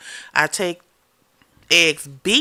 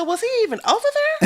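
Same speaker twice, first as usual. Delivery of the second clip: much higher, fast disbelieving question; high incredulous squeal; 4 dB louder, much faster than usual. Retold or imitated. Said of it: "I said".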